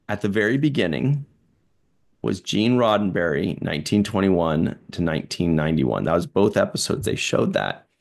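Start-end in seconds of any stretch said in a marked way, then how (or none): none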